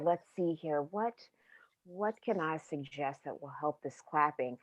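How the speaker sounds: hushed scheming whisper. Acted. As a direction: whisper the plan to yourself. Character schemes to herself.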